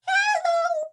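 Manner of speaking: high squeaky silly hello. Shown as a demonstration. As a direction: greet in a goofy high voice. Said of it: "a silly voice".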